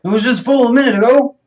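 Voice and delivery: loud, high, rushed outrage; mad old man. Acted. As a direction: scold in acted indignation. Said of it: "the old man gets mad".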